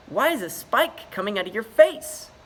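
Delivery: put-on high, loud chirpy young voice; bright eager greeting; cheerful beluga. Trapped beluga Dilly greets Ichabod, high and chirpy.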